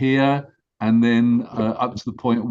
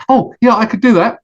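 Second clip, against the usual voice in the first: higher, cheerful goose agreeing; happy to help, say it brightly higher; eager goose; 7 semitones higher, 7 dB louder than usual. Acted. Goose agrees to fly, cheerfully higher.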